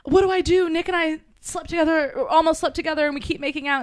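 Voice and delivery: high-pitched mock fretting; retold fretful plea. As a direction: act out a flustered call to a friend. Retelling a panicked call to friend.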